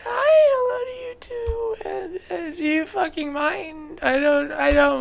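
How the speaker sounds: fake-weep the words out; tearful wail in a high voice, clearly put on; mock crying. Mock tearful reply to a comment.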